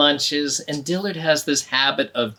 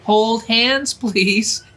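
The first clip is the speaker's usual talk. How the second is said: a hint of an urging teacher, higher and louder; 7 semitones higher, 6 dB louder than usual. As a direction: hurry the child along. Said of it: "the teacher said".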